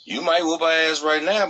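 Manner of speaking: ordinary delivery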